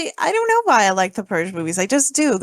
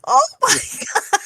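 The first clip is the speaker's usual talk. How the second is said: laughing disbelief; 13 semitones higher than usual. Retold or imitated. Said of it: "I'm like".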